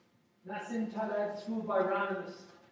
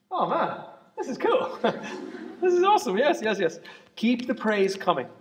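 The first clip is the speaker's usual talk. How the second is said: delighted; 3 semitones higher, 11 dB louder than usual. Real, not acted. Lecturer reacting to praise from the class, delighted and laughing.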